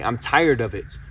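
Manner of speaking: usual speaking voice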